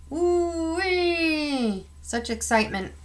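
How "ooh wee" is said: high falling siren whoop; siren wail. A narrator's reading. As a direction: let the siren wind down. Siren imitated after clangs.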